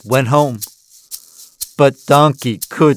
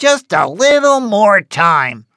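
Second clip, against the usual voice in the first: goat voice; 10 semitones higher than usual. A narrator's reading.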